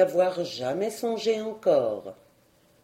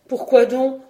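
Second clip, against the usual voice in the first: raised, louder question of surprise; astonished; 5 semitones higher, 7 dB louder than usual. A narrator's reading. Cousin questions Marguerite's plea, astonished. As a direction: ask higher, taken aback. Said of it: "reprit le cousin tout étonné".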